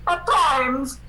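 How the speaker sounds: ordinary talk, nothing marked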